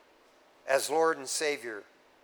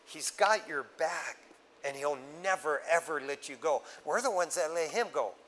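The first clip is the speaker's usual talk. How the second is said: ordinary delivery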